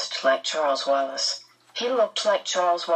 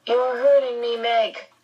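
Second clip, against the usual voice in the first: higher, sharp complaint; 6 semitones higher than usual. A narrator's reading. Charles protests to Meg as she grips his arm, sharp. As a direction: object sharply, pitch raised. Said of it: "Charles said sharply".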